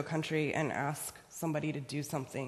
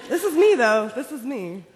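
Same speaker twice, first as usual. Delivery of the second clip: excited; 8 semitones higher, 13 dB louder than usual. Real, not acted. Seeing her old photo.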